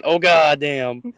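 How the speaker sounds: drawn-out, slow mock exclamation; imitated friend's groan. Friend watching the table dance from behind, imitated drawn-out groan. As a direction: stretch it out like an envious onlooker.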